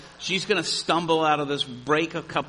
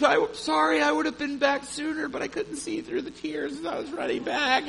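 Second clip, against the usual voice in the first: tearful woman; 13 semitones higher than usual. Retold or imitated. Weeping woman voiced in story.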